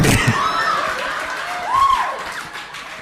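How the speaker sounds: sudden high burst of laughter; a real, hearty laugh. Real, not acted. Laughing at his own threat.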